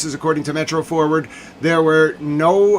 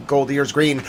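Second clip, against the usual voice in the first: ordinary talk, nothing marked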